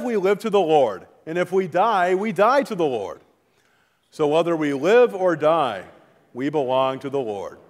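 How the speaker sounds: usual speaking voice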